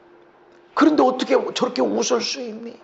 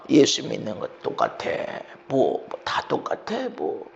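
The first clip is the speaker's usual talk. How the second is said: lower, softer dismissive scoff; a hint of scoffing onlooker; 13 semitones lower, 7 dB quieter than usual. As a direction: shrug it off like a cynic. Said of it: "그렇게 돼버립니다". (낮게 비웃듯 무시하는 말투)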